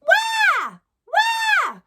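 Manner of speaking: put-on very high, loud baby-cat "wah"; squeaky cat wail. Shown as a demonstration. Teacher showing students the cat or baby sound, squeaky wail. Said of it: "we wanna sound like a cat".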